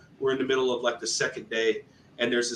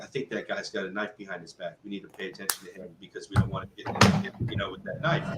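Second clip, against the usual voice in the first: low, much softer aside to the team; hushed warning; 4 semitones lower, 5 dB quieter than usual. Retold or imitated. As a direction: discreetly warn them, keeping it down and low. Teammate quietly warns about a knife.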